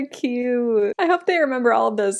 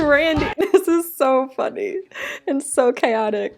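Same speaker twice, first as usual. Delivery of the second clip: amused laughing; 2 semitones higher than usual. Real, not acted. Viewer finds the name Randy so funny, laughing. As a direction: say it through real laughter.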